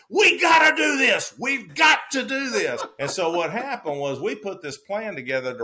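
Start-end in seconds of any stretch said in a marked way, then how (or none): 0.1-2.8 high, loud eager yell; retelling a buddy's eager outburst; excited shout. Retold or imitated. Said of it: "Joe Rose jumps up and says".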